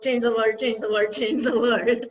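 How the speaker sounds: rapid repeated plea, poking gentle fun; insistent mock prayer. Devotee constantly praying to God for change, mock-insistent. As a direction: mimic someone nagging God over and over. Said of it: "constantly going to pray to God".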